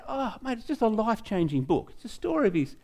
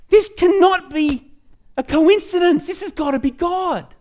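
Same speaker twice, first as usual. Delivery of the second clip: freaking out; 10 semitones higher, 6 dB louder than usual. Retold or imitated. Telling the group how the call came mid-prayer, amazed and louder. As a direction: sell the disbelief that it's a sign. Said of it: "I'm freaking out".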